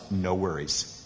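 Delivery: usual speaking voice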